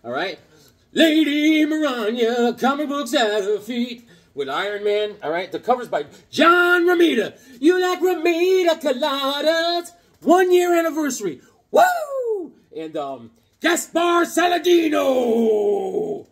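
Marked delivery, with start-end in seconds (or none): 0.9-12.5 high, loud comic-fan gush; excited. Real, not acted. Showing off his favourite comic to viewers, high and thrilled. 13.6-16.3 very slow, high, loud name call; grand announcement. Real, not acted. Announcing the letterer's name to viewers, drawn-out and grand.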